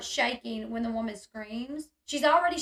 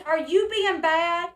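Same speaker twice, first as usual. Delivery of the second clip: menacing scold; 4 semitones higher, 7 dB louder, slower than usual. Retold or imitated. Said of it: "She says".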